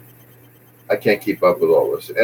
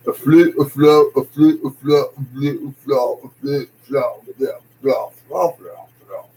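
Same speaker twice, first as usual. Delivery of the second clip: higher-pitched hammed-up Cockney tongue twister; mock Cockney accent; 5 semitones higher than usual. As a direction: parody Cockney, chanting the words until they blur. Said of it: "the Cockney accent".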